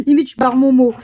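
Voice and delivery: laughing at her own clumsy mistake; laughing at herself. Real, not acted. To her viewers, laughing loudly at her own slip. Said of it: "je fais ça n'importe comment".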